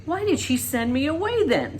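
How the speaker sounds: a hint of a shaking voice; boy near tears asking his aunt. A narrator's reading. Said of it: "His voice shook".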